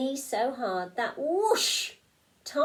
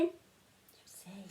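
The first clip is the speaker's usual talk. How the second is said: whisper; 8 dB quieter than usual. Real, not acted. Aside to listening children.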